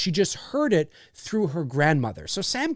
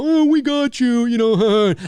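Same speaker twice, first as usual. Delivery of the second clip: lightly voiced joking prank caller, louder and higher, with a laugh; mock prank caller; 6 semitones higher, 7 dB louder than usual. A prank caller teasing Sam, a light mocking laugh. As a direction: tease it like a harmless prank. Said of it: "This is not".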